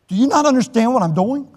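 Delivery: a robber losing patience, half shouting; exasperated robber. Retold or imitated. Frustrated robber in retold skit.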